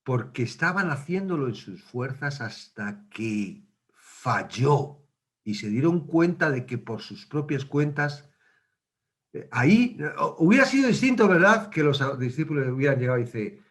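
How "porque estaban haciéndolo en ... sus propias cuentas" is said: ordinary talk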